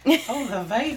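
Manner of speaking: swooning. Pretend. Mock fainting exclamation.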